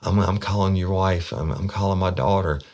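high, mildly mocked-up distress; retold freak-out. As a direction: imitate her alarmed outburst without truly screaming. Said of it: "she started freaking out. She was screaming my name".

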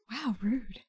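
under-the-breath mutter; whispered aside. Acted. Character muttering to herself inside the crate. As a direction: say it quietly to herself. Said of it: "whispering over the padding".

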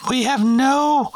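put-on high, polite teen cashier; chirpy drive-thru kid behind the counter; fast food cashier voice. McDonald's cashier roleplay.

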